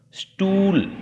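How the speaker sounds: high, loud, drawn-out model word; word read aloud loudly. Shown as a demonstration. Teacher models a word for pupils to say aloud, loudly. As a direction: call the word out for pupils to copy. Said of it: "उच्च स्वर में बोलने के लिए".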